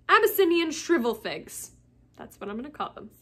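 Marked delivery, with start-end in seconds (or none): 0.1-1.7 higher, firmer delivery after hesitation; decided. Real, not acted. Reader settling on a plant name, decidedly. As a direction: commit to the name with confidence.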